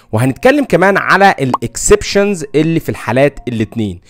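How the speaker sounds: ordinary talk, nothing marked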